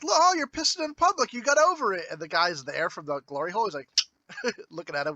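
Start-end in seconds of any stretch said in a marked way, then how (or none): 0.0-2.1 high, teasing jeer; mocking Lance. Lance teases Ian in prison, high mocking jeer. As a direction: needle him with a high taunt.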